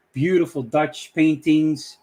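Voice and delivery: ordinary delivery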